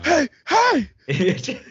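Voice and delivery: loud, high ad-lib shout; hype shout. Acted. Hyping up [name] in the shower, loud shout. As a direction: yell it like a rap ad-lib.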